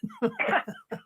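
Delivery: a sudden high burst of laughter; high breathy laugh. Real, not acted. Laughing about the lady who scoffed at the word medium. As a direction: laugh out at how absurd it sounds.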